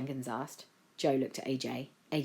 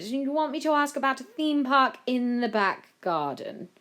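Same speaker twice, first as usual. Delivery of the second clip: higher-pitched aide, worn out by demands; harried Jenkins; 7 semitones higher, 4 dB louder than usual. A narrator's reading. Jenkins sums up the children's wild plans, harried. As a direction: reel off the list in exasperation.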